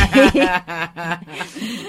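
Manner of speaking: a hearty real laugh; loud burst of laughter. Real, not acted. Laughs at the long reading. (gargalhada alta e espontânea)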